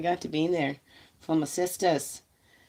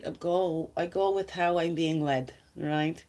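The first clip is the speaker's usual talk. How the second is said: usual speaking voice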